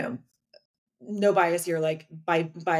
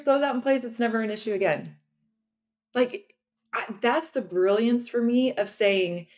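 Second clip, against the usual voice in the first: ordinary talk, nothing marked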